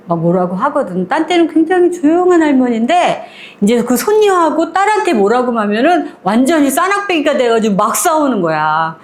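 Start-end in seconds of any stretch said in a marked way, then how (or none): none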